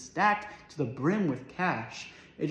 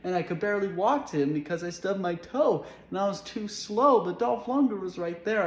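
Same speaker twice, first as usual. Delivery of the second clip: overwhelmed lament; 6 semitones higher than usual. Acted. Overwhelmed rant about lost toe.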